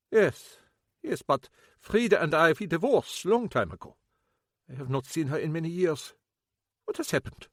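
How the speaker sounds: unsettled, pressing for news; shaken German ex-husband. Acted. Kappelhoff shaken.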